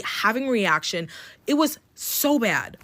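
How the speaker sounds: ordinary talk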